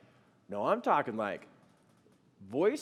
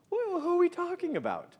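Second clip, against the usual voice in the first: high, loud stammered question; incredulous, pitched way up; retold bewilderment; 7 dB louder than usual. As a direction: act out a totally lost husband. Husband baffled by wife's claim.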